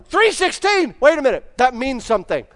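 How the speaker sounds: calls out high and loud across a gym; trainer yelling across the gym. Retold or imitated. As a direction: shout it across the room, puzzled and excited. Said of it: "the trainer from the other side of the gym yelled".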